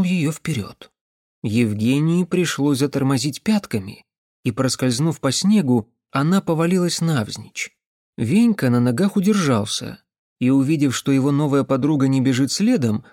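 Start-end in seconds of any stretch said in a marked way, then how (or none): none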